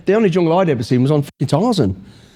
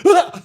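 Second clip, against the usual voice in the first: sudden high-pitched shriek of fright; short high scream of surprise; startled yelp. Retold or imitated. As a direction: yelp like you've just been caught mid-crouch. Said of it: "I'm like, I went".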